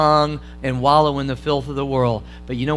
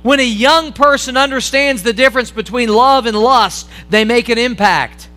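loud, higher impassioned preaching; preaching at full force; 7 semitones higher, 7 dB louder than usual. Acted. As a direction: drive the warning home to the congregation.

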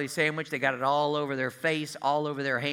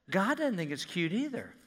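ordinary talk, nothing marked

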